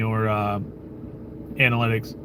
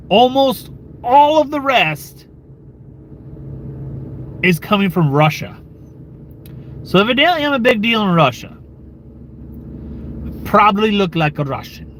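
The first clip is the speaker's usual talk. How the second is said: put-on horrible Russian accent; 7 semitones higher than usual. Streamer joking about his Russian viewers, bad mock Russian accent. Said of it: "That was a horrible Russian accent".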